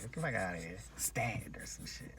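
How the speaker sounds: hushed aside; whisper. Real, not acted. Whispering a dismissal of the protester. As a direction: whisper it under your breath.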